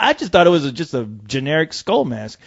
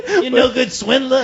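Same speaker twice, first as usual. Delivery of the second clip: Lando scolding; 8 semitones higher than usual. Acted. Lando voice greeting Han Solo.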